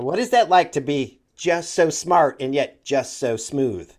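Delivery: ordinary talk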